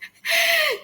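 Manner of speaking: high, broken laugh-cry; a laugh that cracks into crying; half laugh, half sob. Retold or imitated. Reaction to doctor's blunt advice.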